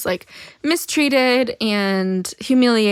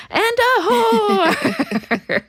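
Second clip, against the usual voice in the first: laughing; 13 semitones higher, 4 dB louder, much slower than usual. Real, not acted. Reading the alien's list of insults.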